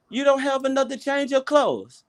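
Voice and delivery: high-pitched imitation of Indonesian coworker; high, inquiring coworker voice. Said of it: "she came to me, said".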